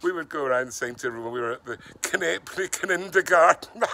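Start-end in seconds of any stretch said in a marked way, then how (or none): 1.3-3.8 Knapely Kindergarten with the K sounded as "kuh-napely", higher and louder; Knapely said "k-NAPE-lee", the K fully voiced; sounding the silent K. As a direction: kids proudly sounding the K in Knapely. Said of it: "didn't get this silent K thing".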